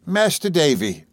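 high, drawn-out, faintly breathless nurse; slow, high address to a child; a touch of breathless nerves. A narrator's reading. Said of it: "speaking in a breathless sort of way".

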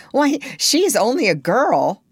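put-on high-pitched boyish indignation; aghast. A narrator's reading. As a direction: protest in appalled disbelief. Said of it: "John said aghast".

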